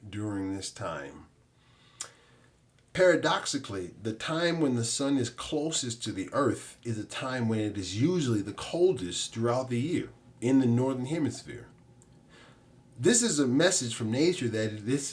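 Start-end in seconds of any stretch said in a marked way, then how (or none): none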